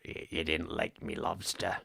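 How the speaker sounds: low, slow sailor voice. Retold or imitated. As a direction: drop the voice and drag it out. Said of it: "get more Defoe".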